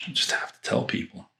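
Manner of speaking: a breathy whispered aside. Real, not acted. Reviewer whispering an aside to viewers, hushed. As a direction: drop to a secret whisper. Muttered aside about the seller.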